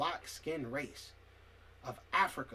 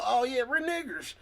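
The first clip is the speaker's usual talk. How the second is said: mocking quote; 9 semitones higher, 6 dB louder than usual. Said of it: "And you go".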